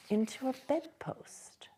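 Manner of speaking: hushed whispered reveal. A narrator's reading. Narrator revealing the phantom is a bedpost, whispered. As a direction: let it vanish in a whisper.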